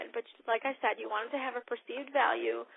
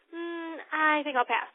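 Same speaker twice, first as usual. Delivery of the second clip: guest voice; 5 semitones higher, 4 dB louder than usual. Retold or imitated. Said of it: "Mary says".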